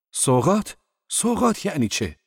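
high, loud, indignant questioning; angry. A narrator's reading. Boss questions Zorba about the gifts, angrily. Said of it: "با لحنی خشمگین گفتم".

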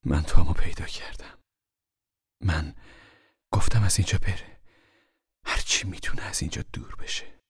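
gently hushed confession after a sigh; soft and quiet. A narrator's reading. Mother confiding news of her son, soft and quiet. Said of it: "نرم و آهسته گفت".

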